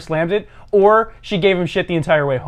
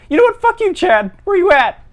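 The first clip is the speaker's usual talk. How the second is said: high, louder squeaky challenge; mock outburst; 13 semitones higher, 4 dB louder than usual. Bullied caller turning on Chad, high mock fury. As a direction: finally stand up to the bully, voice shooting up.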